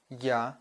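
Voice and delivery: "ya" opened with a y-glide; soft vowel. Shown as a demonstration. Teacher models vowels for learners, soft "ya". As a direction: say "ya" as the soft counterpart of a. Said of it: "makes consonant to be soft".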